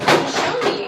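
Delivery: high, loud eager prompt. Acted. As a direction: push him to show you, excited. Parent urging child to show.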